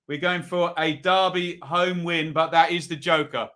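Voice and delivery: normal talking voice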